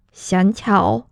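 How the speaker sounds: normal talking voice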